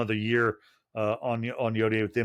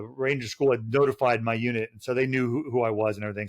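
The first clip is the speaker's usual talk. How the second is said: ordinary delivery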